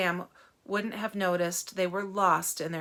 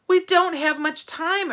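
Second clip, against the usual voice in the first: louder, higher urgency; urgent loud plea; urging; 8 semitones higher, 8 dB louder than usual. Acted. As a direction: press him to act. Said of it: "urged Catherine".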